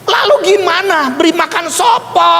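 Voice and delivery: high, insistent questions; exasperated questioning. Retold or imitated. Preacher replaying his questions to the pastor, exasperated.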